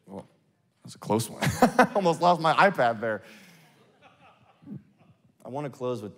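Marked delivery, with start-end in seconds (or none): 1.4-3.2 higher, louder words through a laugh. Real, not acted. Preacher to audience after nearly dropping iPad, laughing. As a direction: laugh out the relief at a near slip.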